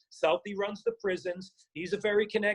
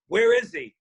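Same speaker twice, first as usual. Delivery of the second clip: loud, higher-pitched demand to know; retold urgent question; 6 semitones higher, 8 dB louder than usual. Prison buddy asking after his old cellmate's brother, urgent and loud. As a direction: burst out with the question, louder.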